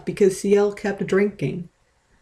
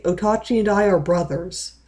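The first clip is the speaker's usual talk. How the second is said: usual speaking voice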